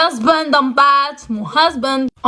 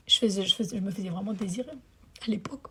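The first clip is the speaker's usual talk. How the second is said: soft aside; 2 semitones lower, 14 dB quieter, faster than usual. Real, not acted. Confiding aside.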